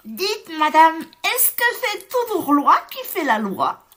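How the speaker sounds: high, bright little chick voice asking; curious chick. Acted. Chick asking the pig about his curly tail, high and innocent. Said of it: "et qui demande".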